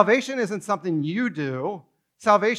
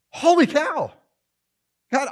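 higher, astonished holy cow; high stunned exclaim; 7 semitones higher, 4 dB louder than usual. Pretend. Preacher repeating his shock at God, astonished exclaim. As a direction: exclaim in disbelief, pitched up.

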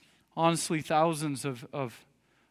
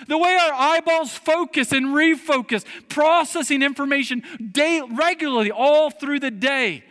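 high, loud preacher's delivery; impassioned preaching; 11 semitones higher, 8 dB louder than usual. Acted. Preacher pressing congregation on who made their bodies, impassioned preaching. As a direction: drive it home, loud and high-pitched.

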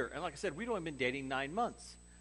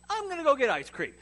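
blurted excuse; 11 semitones higher, 10 dB louder than usual. Retold or imitated. Abrupt excuse to leave room.

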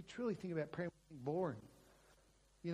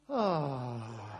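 long, contented falling sigh; romantic sigh; 6 semitones lower, 3 dB louder than usual. Retold or imitated. Wife held in her husband's arms, imitated romantic sigh. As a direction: imitate a lingering, loving sigh. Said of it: "probably a little bit more romantic".

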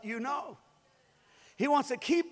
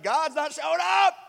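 loud, raised-pitch cry of alarm; mock panic; 4 semitones higher, 7 dB louder than usual. Believer facing Friday's bill with no help, panicked cry. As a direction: build to an exasperated yell.

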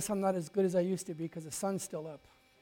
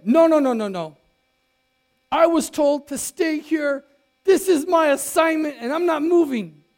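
stubborn refusal; 6 semitones higher, 10 dB louder than usual. Pretend. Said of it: "Now, I could have said".

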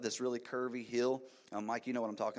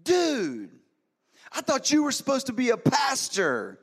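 very high, very loud shout, half sung; shouted retort; 12 semitones higher, 10 dB louder than usual. Retold or imitated. Driver shouting back at the pastor he recognized, loud high mocking shout. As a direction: hurl it back mockingly at full volume. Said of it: "he yelled back at me".

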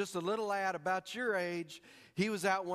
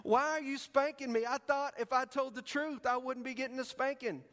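put-on tearful little boy; 8 semitones higher than usual. Little Sam pleads with his dad, mock tearful. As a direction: play the pleading little boy, a hint of tears. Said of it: "with tears in his eyes".

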